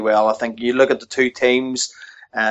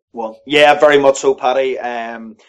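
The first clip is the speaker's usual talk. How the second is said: usual speaking voice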